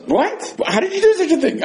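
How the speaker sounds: be scandalized by the answer; astonished. Acted. Rav Zera reacting to the skipped blessings, high astonished cry.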